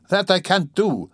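high, slow indignant refusal; offended, drawn-out high protest; offended cry. A narrator's reading. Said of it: "the girl cried, offended".

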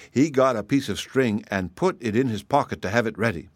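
ordinary delivery, nothing marked

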